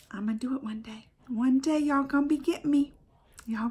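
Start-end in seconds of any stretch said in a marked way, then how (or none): 0.1-1.1 soft determined whisper; whispered vow. Real, not acted. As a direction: promise it to yourself, hushed. Quiet promise about her goal.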